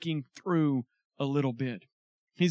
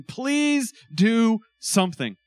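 louder, higher, drawn-out plea; frustrated plea; 5 semitones higher, 5 dB louder, slower than usual. Acted. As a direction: plead slowly, leaning on each word. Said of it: "turning to a plea".

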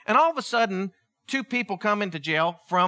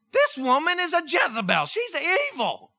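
high, emphatic outrage; indignant exclamation; 8 semitones higher than usual. Real, not acted. Preacher denouncing Potiphar's wife to the congregation, high indignant exclamation. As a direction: exclaim it high with scandalized force.

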